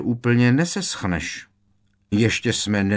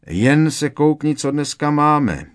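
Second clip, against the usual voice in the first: higher, louder fed-up urging; 7 semitones higher, 5 dB louder than usual. A narrator's reading. Ron showing his brother the timetable, fed up. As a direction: complain in exasperation, pitched up. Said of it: "Vybídl ho otráveně Ron".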